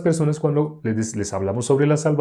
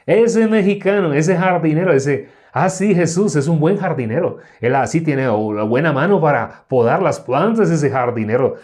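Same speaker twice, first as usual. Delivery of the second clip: loud, high-pitched American gringo speaking Spanish; exaggerated American accent in Spanish, raised and lively; 6 semitones higher, 5 dB louder than usual. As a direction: put on a hammed-up gringo accent. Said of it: "así más o menos hablaría un gringo".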